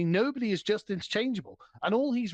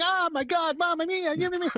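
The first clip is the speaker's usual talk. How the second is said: high, hammed-up Italian accent; 11 semitones higher, faster than usual. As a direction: ham up an Italian, pitched way up. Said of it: "with the, the Italian fingers going".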